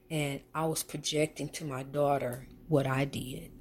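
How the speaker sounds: ordinary talk, nothing marked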